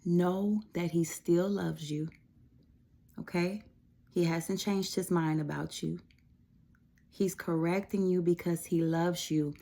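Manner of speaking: ordinary talk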